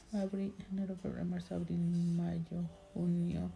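thinking aloud, listing months very softly; counting under breath. Real, not acted. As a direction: count the months quietly to yourself.

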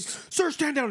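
high, mock-stern order; mock cop command. Cop ordering the suspect to stand down, mock stern. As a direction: bark the order like a jittery cop.

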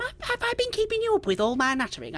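put-on high, apologetic old dear; fussing old woman Margaret. Old lady worried she has kept the traveller up, fussing. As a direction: fuss like a chatty old lady.

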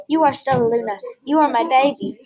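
ordinary delivery

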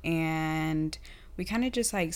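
ordinary delivery

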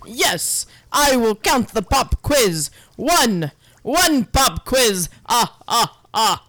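slow Transylvanian count. Acted. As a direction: draw out "One" like the Count. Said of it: "That was really good, Count".

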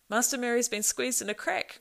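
contained cry of alarm; urgent cry. A narrator's reading. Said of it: "cried Sam".

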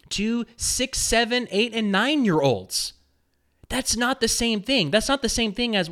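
rising indignant rant; outraged. Real, not acted.

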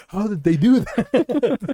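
high, slow, laughing mock wonder. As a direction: marvel while laughing.

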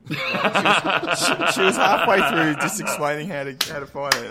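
words breaking into laughter; laughing while talking. Real, not acted. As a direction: laugh through the whole sentence. Laughing at interruption.

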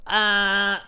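high mock scream; a short high yelp like a kid on tape; shrill-free high "Ah!" mock yell; retold childhood scream. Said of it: "I was just screaming like".

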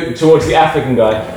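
normal talking voice

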